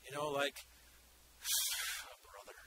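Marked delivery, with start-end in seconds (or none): 1.4-2.1 long, heavy sigh; demonstrated sigh. Shown as a demonstration. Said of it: "in the Greek means to sigh". 2.1-2.6 very soft grumbling under the breath; low-volume exasperated murmur; murmured grumble. Acted. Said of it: "means to sigh, to murmur".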